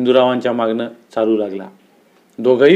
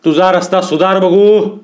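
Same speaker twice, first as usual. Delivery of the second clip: higher, slow, drawn-out angry order; storyteller's angry command, stretched out; thundering dismissal; 5 semitones higher, 3 dB louder than usual. A narrator's reading. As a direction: send him off sternly. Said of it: "Dada कडाडले".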